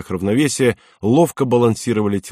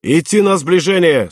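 high, raised officer's command; commanding call; 6 semitones higher, 3 dB louder than usual. A narrator's reading. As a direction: call the order across the deck. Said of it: "скомандовал вахтанг".

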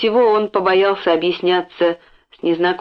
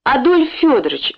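pleading; 6 semitones higher than usual. A narrator's reading. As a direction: beg the owner by name. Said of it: "взмолился он". (умоляюще, громче и выше)

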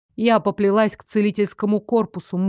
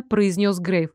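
plain reading voice

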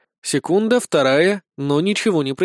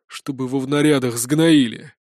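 grumbling; 2 semitones lower than usual. Acted. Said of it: "недовольно буркнул".

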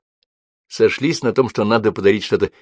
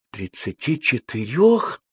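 slow, rising astonished question; choking with surprise; 5 semitones higher, slower than usual. A narrator's reading. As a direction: gasp it out in disbelief. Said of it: "Захлебнулась Рима".

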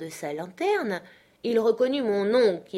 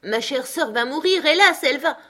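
higher and louder, a lament that swells to a howl on hélas; drunken wailing shout; 8 semitones higher, 6 dB louder than usual. Acted. Drunk Benjamin howling that his sister will die, loud wail. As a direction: bellow the grief loud enough to wake the whole street. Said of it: "s'écria-t-il d'une voix réveillée toute la rue".

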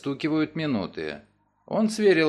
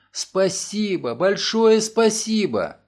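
grateful thanks; 6 semitones higher, 3 dB louder, slower than usual. Acted. Grateful woman thanks lawyer.

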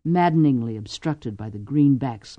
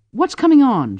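sharp, higher and louder alarmed question; 9 semitones higher, 8 dB louder than usual. Acted. Lady on the roof asking the guard, alarmed. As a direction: call out in sudden alarm. Alarm after a grenade blast.

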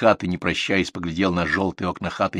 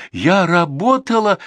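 high, loud, drawn-out protest; tearful outcry; 12 semitones higher, 7 dB louder, slower than usual. A narrator's reading. Valentina defends herself against the manager, crying out. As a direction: cry out in hurt indignation. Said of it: "выкрикнула она".

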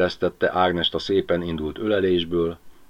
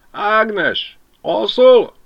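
much higher, louder woman's call; Mari calling; 7 dB louder, much slower than usual. Acted. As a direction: Mari calling Ágnes through the window, high voice.

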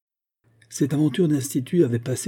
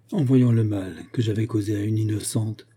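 usual speaking voice

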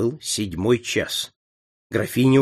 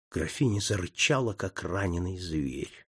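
ordinary delivery

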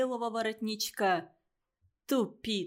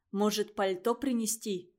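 plain reading voice

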